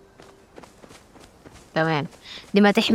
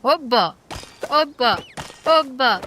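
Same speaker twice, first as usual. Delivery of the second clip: rising excited calls of hooba; 5 semitones higher, slower than usual. Real, not acted. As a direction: cheer it out, each one punched. Excited gaming exclamation.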